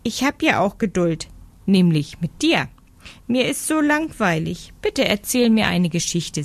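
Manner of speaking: höher gesprochene Meise, quengelnd und bettelnd; gelangweilte kleine Meise. Acted. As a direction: wie ein Kind, das um eine Geschichte bettelt. Meise quengelt vor Langeweile. (Meisenstimme, höher, quengelnd)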